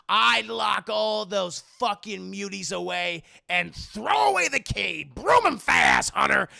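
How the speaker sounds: loud, drawn-out mock tirade; ranting shout. Senator tells his assistant what he'd do, ranting loudly.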